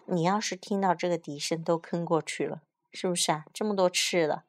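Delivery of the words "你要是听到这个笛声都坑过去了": ordinary delivery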